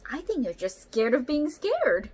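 a snake firmly encouraging a friend. A narrator's reading. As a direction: set her straight, firmly. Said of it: "says Snake firmly".

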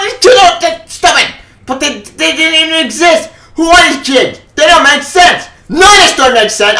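real furious ranting shout. Real, not acted. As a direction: blow up at the book in real anger. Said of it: "I'm very pissed off and angry".

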